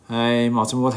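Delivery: ordinary talk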